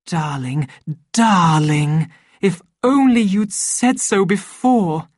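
gushing, swelling declaration of love; ardent delight. A narrator's reading. Lenina advancing on the man she desires, ardent and rising. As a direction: voice lifting into rapturous endearment.

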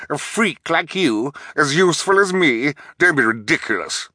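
high-pitched scornful disbelief; snapping. A narrator's reading. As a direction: snap back, offended at the comparison. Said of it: "the bald man snapped".

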